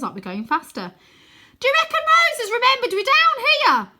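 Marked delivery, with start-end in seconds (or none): 1.6-3.9 put-on very high, loud boy's shout. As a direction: yell the worried question. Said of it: "shouts Wynne".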